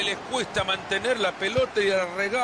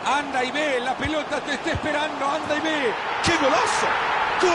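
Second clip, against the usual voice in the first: breakneck goal commentary; 4 semitones higher than usual. Real, not acted. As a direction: build up, then explode on the goal. Commentator calls a goal live.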